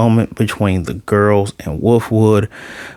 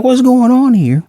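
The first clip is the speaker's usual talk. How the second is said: startled cry; 13 semitones higher, 4 dB louder than usual. Retold or imitated. Millie shocked by the worm.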